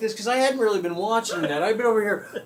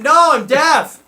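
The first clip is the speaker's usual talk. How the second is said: high, loud, laughing fib; loud mock reply; 8 semitones higher, 13 dB louder than usual. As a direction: shout the joke back.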